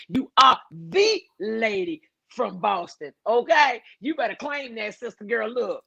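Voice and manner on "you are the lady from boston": slow, with THE drawn out and set apart; stress on THE. Real, not acted. Host builds up modest viewer, stress on THE. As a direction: lean hard on "the", then pause.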